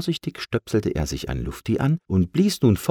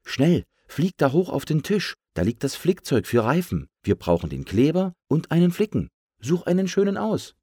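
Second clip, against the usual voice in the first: slightly raised pump voice giving orders; lightly voiced pump in charge; urgent pump; 8 semitones higher than usual. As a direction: direct a helper briskly. Pump gives the sparrow orders.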